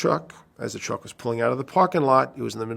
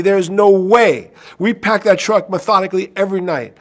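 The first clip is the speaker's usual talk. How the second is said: defensive protest; 8 semitones higher, 8 dB louder than usual. Retold or imitated. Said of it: "he says to me".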